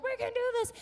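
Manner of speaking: very high mock team reply; team echo. Players answer their coach in the huddle, high-pitched echo. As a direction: squeak back the rallying cry. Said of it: "and they're like".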